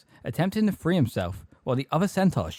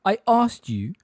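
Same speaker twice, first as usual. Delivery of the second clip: loud, higher-pitched rough challenge; rough demand; 10 semitones higher, 7 dB louder than usual. A narrator's reading. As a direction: demand an answer roughly, with force. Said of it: "said Megorian roughly".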